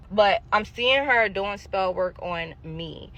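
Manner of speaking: ordinary talk, nothing marked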